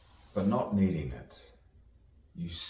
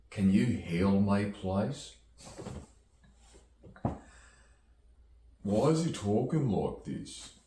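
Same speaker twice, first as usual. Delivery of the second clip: higher, silly put-on voice; funny voice; 4 semitones higher, 4 dB louder than usual. Performer mocking a request to do a funny voice, funny voice. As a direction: put on a comic voice, pitched up and swooping. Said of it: "Can he do a funny voice".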